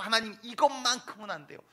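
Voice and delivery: loud, high, clutching cry; desperate plea. Acted. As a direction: beg God to spare this one thing. Believer clinging, pleading with God.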